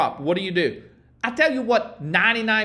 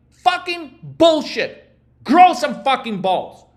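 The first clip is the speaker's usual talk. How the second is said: slow, louder, angry outburst; 4 semitones higher, 6 dB louder, slower than usual. Real, not acted. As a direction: spit it out at the excuse-makers. Coach blowing up at excuses.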